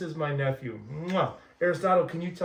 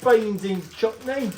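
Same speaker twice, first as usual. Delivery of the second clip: slow hammed-up British accent; a mock posh British repeat; 5 semitones higher, 4 dB louder, slower than usual. Uncle echoing brother in mock British accent. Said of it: "British word".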